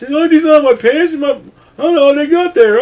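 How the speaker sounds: high, loud mock whine of a caught cheater; mock whiny excuse. Caught wrestler pleads innocence in a high mock whine. Logan Paul caught with knucks.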